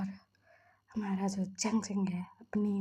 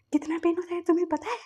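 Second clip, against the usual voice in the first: higher, fast accusing whine; indignant complaint; 7 semitones higher, 3 dB louder, faster than usual. Acted. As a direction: scold him while chasing with a broom. Accusing husband with a broom.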